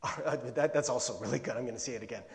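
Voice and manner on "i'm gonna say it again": ordinary delivery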